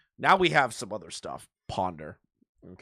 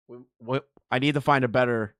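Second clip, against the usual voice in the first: ordinary delivery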